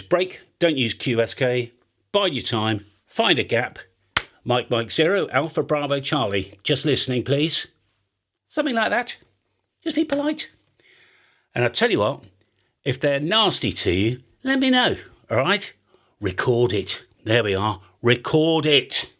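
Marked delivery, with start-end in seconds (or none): none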